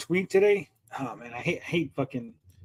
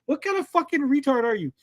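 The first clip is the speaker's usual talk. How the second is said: incredulous scolding; 8 semitones higher than usual. Real, not acted. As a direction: demand an answer in disbelief. Berating the tweet's author, incredulous.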